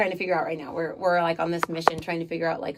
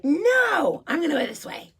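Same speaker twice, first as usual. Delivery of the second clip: put-on stubborn kid; 10 semitones higher than usual. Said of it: "we're like".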